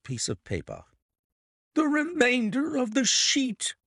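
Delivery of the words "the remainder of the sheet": high-pitched exclamation of discovery; excited cry. A narrator's reading. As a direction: cry out in amazed delight. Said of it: "cried the inspector".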